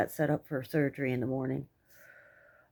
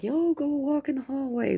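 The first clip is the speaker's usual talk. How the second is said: mocking hospital staff; 9 semitones higher than usual. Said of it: "And they're like".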